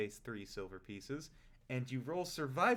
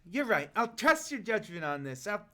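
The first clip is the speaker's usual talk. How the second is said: much higher, louder goblin voice; goblin giving in; 10 semitones higher, 10 dB louder than usual. Acted. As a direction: squeak it out, suddenly won over. Goblin gives in to ogre.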